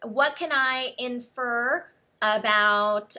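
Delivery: ordinary talk, nothing marked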